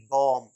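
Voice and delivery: बॉम्ब said "bawm", final B silent; drawn "aw" closing on M in बॉम्ब; correct pronunciation. Shown as a demonstration. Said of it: "बम नहीं". (बॉम्ब में लंबा "ऑ", B चुप)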